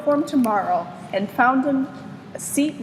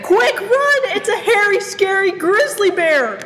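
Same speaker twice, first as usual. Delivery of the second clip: much higher, louder panicked yell; frightened shout; 9 semitones higher, 5 dB louder than usual. A narrator's reading. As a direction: scream it running away. Rats panic.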